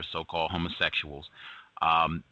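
ordinary delivery

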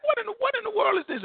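high, fast, startled; retold astonishment. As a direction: blurt it out in disbelief. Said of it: "I was like".

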